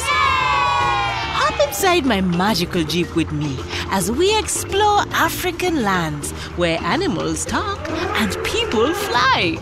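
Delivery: plain reading voice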